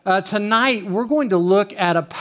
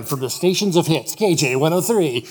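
imitated station ID announcer; radio jingle voice. Prank buddies faking station ID, radio announcer voice. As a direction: announce it like a background station tag. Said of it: "our guys in the back going".

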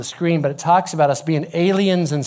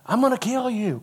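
higher-pitched mock threat, not shouted; half-hearted high-pitched threat; 6 semitones higher than usual. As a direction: playfully imitate an angry chaser. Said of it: "she started running after Braden".

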